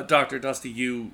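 ordinary talk

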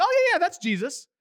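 high, loud mock realization; exaggerated high "oh yeah" of recognition; casual recognition. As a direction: toss it off as an easy, obvious spot. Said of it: "just going like".

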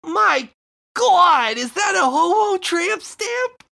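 high, loud shocked gasp. Acted. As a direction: recoil at the tattoo in loud shock. Shopkeeper shocked at tattoo.

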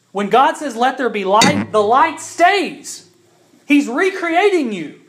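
loud, high pulpit proclamation; full-force preaching. Acted. To the congregation about creation, preached loud. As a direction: preach it out with conviction.

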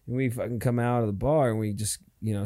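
ordinary delivery

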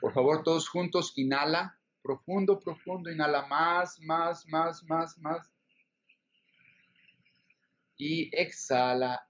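drawn-out breathing cues; slow breath counting. Shown as a demonstration. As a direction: guide the breath, stretching each word. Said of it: "Vamos a hacerlo por como un minuto".

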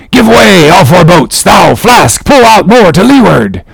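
high, shouted captain's commands; crying orders. A narrator's reading. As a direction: holler to all the boats. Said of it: "cried Ahab".

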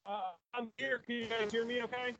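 distant, muffled underwater sound; bad connection, far away; far from mic. Real, not acted. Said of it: "It sounds like you're far away and underwater".

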